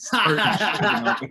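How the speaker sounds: giggling as it comes out; laughing. Real, not acted. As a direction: laugh through the words. Laughing through the punchline.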